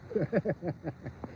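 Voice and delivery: soft staccato chuckle. Real, not acted. Laughs after own fish suggestion.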